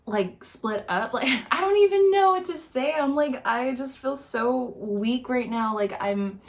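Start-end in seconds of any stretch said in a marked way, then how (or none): 1.1-5.3 real exasperated laugh; laughing in disbelief. Real, not acted. Helpless disbelief breaks into laughter.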